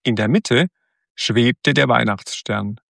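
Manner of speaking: usual speaking voice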